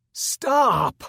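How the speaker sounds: high, loud cry of pain; loud anguished plea; begging scream. A narrator's reading. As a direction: plead as the horns are torn off. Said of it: "I begged".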